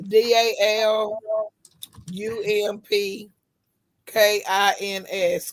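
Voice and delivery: slow letter-by-letter spelling. Shown as a demonstration. Spelling the woman's last name for friends, slow and deliberate. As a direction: spell each letter slowly and clearly. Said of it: "spell her last name again".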